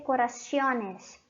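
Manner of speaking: corazones mispronounced as "co-ra-SYO-nes"; wrong plural. Shown as a demonstration. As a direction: slip an i-glide into corazones, "-cio-nes". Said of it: "No existe corazones".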